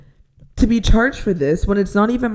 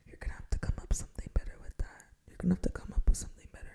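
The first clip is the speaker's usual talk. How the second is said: fast, whispered frustration; keeping her voice down while fed up; hushed whisper; 16 dB quieter, much faster than usual. Retold or imitated. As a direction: whisper it through clenched restraint. Retelling a frustrated demand, hushed.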